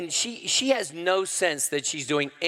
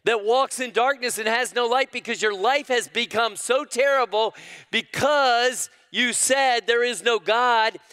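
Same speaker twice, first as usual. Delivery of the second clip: much higher, louder preaching cry; prophet calling out; 10 semitones higher, 5 dB louder than usual. Acted. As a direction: cry it out like a prophet to a lost people. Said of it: "Isaiah calls out to them".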